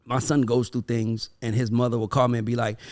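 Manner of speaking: ordinary talk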